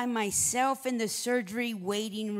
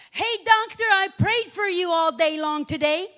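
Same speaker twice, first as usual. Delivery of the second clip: calling out to the doctor, a bit louder; 8 semitones higher, 8 dB louder than usual. Retold or imitated. Wife calls out to the surgeon as he leaves, mildly raised. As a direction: call after him as he walks away. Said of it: "you said".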